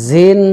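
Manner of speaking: জিন as "zin", with audible buzz. Shown as a demonstration. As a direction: let the z starting জিন buzz. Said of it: "একটু আওয়াজ বের হবে".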